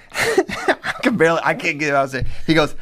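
ordinary delivery

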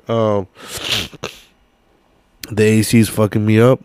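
ordinary talk, nothing marked

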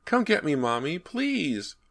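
put-on high child's cry slowing into a drawn-out plea; frightened child's wailing plea; terrified boy crying out. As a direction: beg like a scared little boy. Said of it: "the voice cried".

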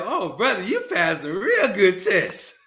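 slow, chuckling praise; laughing. Retold or imitated. Minister laughing as he tells Jesse he passed. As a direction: laugh through the words, slowly. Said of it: "he's laughing now".

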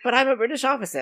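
hint of an affronted British officer insisting on his rank; indignant British officer. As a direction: lightly play the put-out officer protesting. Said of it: "And he's like".

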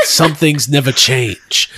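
low, slow tough-guy voice; Vin Diesel. Acted. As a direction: drop it low and take your time. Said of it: "Cut to Vin Diesel".

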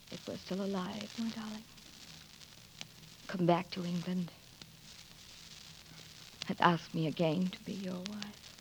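hushed tender farewell. Acted. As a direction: say it softly, holding back tears.

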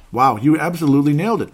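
high, loud amazed praise; excited. Real, not acted. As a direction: gush with amazement. Host praising caller's prediction.

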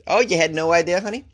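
ordinary talk

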